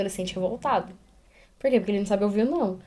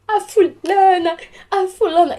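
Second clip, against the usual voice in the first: put-on crying child; 8 semitones higher, 6 dB louder, slower than usual. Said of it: "E ela ficava".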